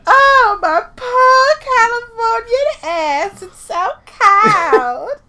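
high whiny mock complaining; whiny mock Californian. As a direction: whine high like a spoiled Californian. Mocking a cold Californian.

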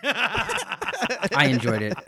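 slow, high, sing-song I enjoyed it; drawn-out mock praise. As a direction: stretch it out teasingly. Teasing reply to embarrassment.

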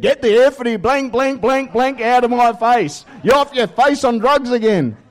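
high, loud furious yell at her son; mum yelling. Retold or imitated. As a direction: scream it at him like an outraged mother.

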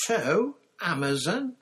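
high, loud, slow outrage; indignant child's horror, swooping high; horrified. A narrator's reading. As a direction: repeat it aghast, drawing out each word. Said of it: "said Nancy in horror".

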